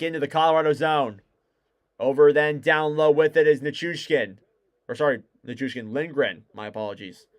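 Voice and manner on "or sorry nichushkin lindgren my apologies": soft, low aside fading away; quiet apology. Real, not acted. Commentator apologizing for a wrong name, quietly. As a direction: drop your voice to correct a slip. Corrects own slip on air.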